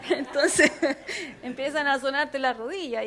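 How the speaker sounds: giggling through the words; laughing. Real, not acted. Preacher describing her knocking knees, talking through laughter.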